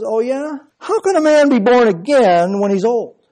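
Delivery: high-pitched sarcastic challenge. Acted. Nicodemus answering Jesus about being born again, sarcastic. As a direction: throw it back as a scornful question. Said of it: "is a sarcastic statement".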